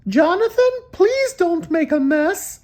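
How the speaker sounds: high, slow motherly plea; mother asking him not to make a mess; mom's voice. A narrator's reading. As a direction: plead gently as his mom. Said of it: "She said".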